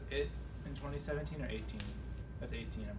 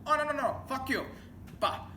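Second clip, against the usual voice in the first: high, loud mock outburst in a put-on accent; mock yell; 13 semitones higher, 4 dB louder than usual. Scahill blowing off the scam caller with a mock yell. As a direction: shriek it off before hanging up. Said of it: "and then he goes".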